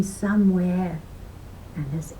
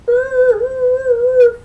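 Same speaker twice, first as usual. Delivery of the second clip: high, loud squeal of a squeezed leprechaun; squeaky pained ooh; 10 dB louder than usual. Acted. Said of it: "cried the leprechaun".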